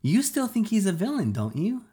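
insistent challenge; demanding. Acted. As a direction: corner her with the question. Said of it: "I demanded".

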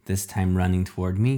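plain reading voice

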